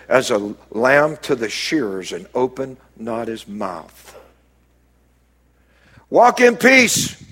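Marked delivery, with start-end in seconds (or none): 6.1-7.2 слова тянутся медленно, высоко: «Walk in peace»; slow exhortation. Real, not acted. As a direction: stretch each word out, lifted and deliberate. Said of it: "preacher exhorting slowly".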